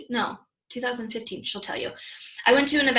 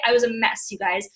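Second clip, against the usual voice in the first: ordinary talk, nothing marked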